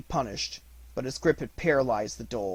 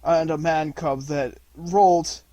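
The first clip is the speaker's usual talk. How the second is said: coughing; 5 semitones higher, 8 dB louder than usual. A narrator's reading. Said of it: "coughed Akela".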